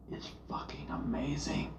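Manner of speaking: hushed, breathless whisper. Real, not acted. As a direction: whisper it in awe. Raving about the movie.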